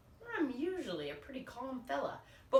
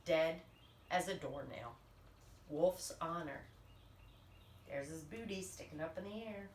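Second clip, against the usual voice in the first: usual speaking voice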